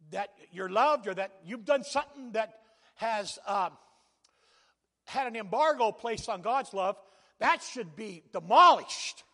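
loud, declaiming scripture with swells on key words; preaching scripture. Acted. Pastor declaims scripture over his congregation, loud preaching. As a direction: thunder the verse out to the pews.

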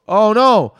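a real shocked gasp of words; dismayed oh no. Real, not acted. Reacting to full startup disk.

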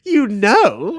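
loud, high incredulous outburst; indignant, high-pitched exclamation; appalled echo. Real, not acted. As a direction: throw it back in disbelief. Appalled echo of co-host's admission.

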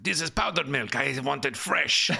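a kingpin rejecting bad goods; cartel drug lord. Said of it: "a sort of a Colombian cartel, uh, drug lord".

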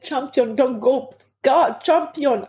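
high pleading cry, held at talking volume; retold screaming. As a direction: plead upward as if screaming, without force. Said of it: "I was screaming".

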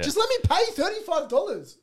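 high, loud exasperated plea. Real, not acted. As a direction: burst out in frustration at the hassle. Ranting about double card payment.

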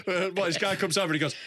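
ordinary talk, nothing marked

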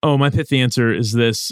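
ordinary talk

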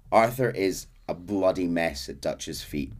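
ordinary delivery, nothing marked

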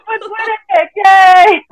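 delighted family yelp; excited squeal. Retold or imitated. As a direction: cheer like you just saw great news. Retold family's joyful reaction.

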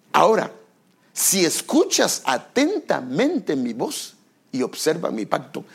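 ordinary delivery

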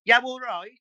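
"Yeah, all right" squashed to "yow-all-roight". Shown as a demonstration. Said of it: "make sentences smaller".

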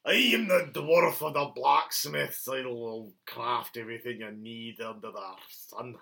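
slow, drawn-out dwarf character voice; dwarf blacksmith. Acted. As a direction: announce yourself slowly as a mythic dwarf. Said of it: "Was he dwarf?".

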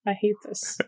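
breathy whisper of disgust. Acted.

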